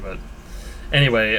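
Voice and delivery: normal talking voice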